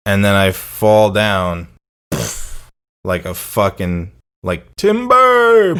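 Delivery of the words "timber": high, loud, drawn-out falling-tree cry; mock lumberjack call. Friend acting out his drunken fall, mock lumberjack holler. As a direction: holler it long like a tree is coming down.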